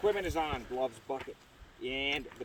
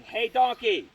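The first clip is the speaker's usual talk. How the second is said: raised call to the belayer, higher and louder; 7 semitones higher, 8 dB louder, slower than usual. Shown as a demonstration. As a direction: call it out across the yard. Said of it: "So I'll start by saying".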